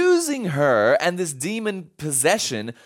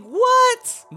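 sudden high, louder disbelieving what; shocked what; 5 dB louder than usual. Retold or imitated. Pastor voicing the listeners' reaction to the jailing, shocked. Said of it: "you would think".

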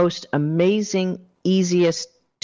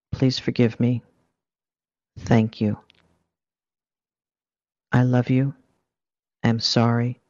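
guided mantra; 3 semitones lower than usual. Acted. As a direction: murmur each phrase like a gentle prayer. Leads the Ho'oponopono repetition.

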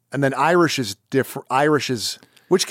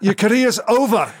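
loud, high Scottish burr; exaggerated Scottish outburst; mock Scottish accent; 8 semitones higher, 4 dB louder than usual. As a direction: put on a Scottish accent, pitched well up. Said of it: "did it sound Scottish".

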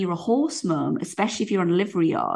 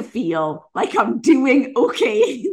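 slow, higher laughing plea; 5 semitones higher, much slower than usual. Real, not acted. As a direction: plead it through a laugh. Pleading, laughing.

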